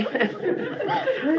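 a short, high, soft laugh; sheepish laugh. Acted. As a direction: laugh off the climbdown. Gives in, staying after all.

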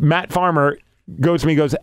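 ordinary talk, nothing marked

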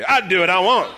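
loud, high mock-defiant chant; rallying shout. Shown as a demonstration. Pastor leading congregation to say it, loud shout. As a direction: lead a crowd in shouting it.

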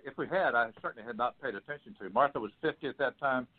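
usual speaking voice

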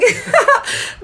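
high embarrassed laugh. Real, not acted. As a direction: laugh at yourself. Laughing at own singing.

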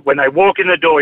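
usual speaking voice

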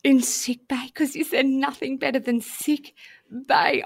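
high, lightly sing-song mock bliss; mock dreamy. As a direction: savour it like a dream holiday. Mock dreamy, echoing son.

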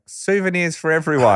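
high, laughing cheer to the crowd; laughing showman. Retold or imitated. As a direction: toss it out grinning, half laughing. Showman tossing balls to crowd.

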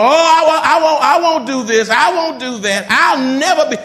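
high, loud mimicry of stubborn boasting; mock vowing. Preacher mimicking people who say what they won't do, loud mock vowing.

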